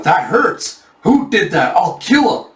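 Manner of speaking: high, building outrage; angry outburst. Acted. Grazed bandit leader to his attacker, high angry cry. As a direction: snap back at the unseen attacker.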